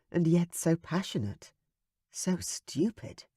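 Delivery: usual speaking voice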